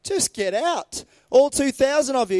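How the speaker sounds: stern commanding rebuke; commanding Jesus. Acted. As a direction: order the demons out with full authority.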